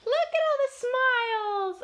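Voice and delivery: high, loud burst of delight; thrilled, sing-out amazement; excited exclamation. Real, not acted. Exclaims over page of smiles.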